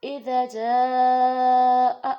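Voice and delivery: جَاءَ held very long and loud, with a catch on the hamza; long madd recitation. Shown as a demonstration. Teacher demonstrating a long madd on جَاءَ. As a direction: stretch جَاءَ slowly to five or six counts. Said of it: "پانچ سے چھ انگلی کے برابر".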